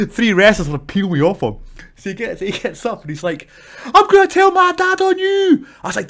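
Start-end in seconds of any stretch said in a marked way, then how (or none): 3.8-5.6 put-on very high, slow bratty kid voice; high whining threat from a teen; whiny kid. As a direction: whine it like a caught teenager. The kid threatening after being choked.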